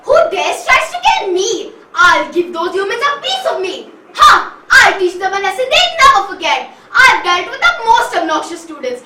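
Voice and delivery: loud indignant battle cry; outraged bluster. Acted. Old teacher vowing revenge on humans, loud bluster. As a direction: bellow defiance at the humans.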